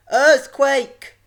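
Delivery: high, very loud shout; high-pitched yell of alarm; alarmed cry. A narrator's reading. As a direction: cry out the alarm at full volume. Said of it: "cried Missus Crisp".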